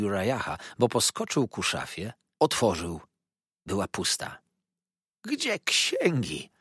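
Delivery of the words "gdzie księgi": high, drawn-out cry of alarm. A narrator's reading. Villain finding the safe empty, high alarmed cry. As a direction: cry out high, stretching the words. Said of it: "zawołał, mieniąc się straszliwie na twarzy".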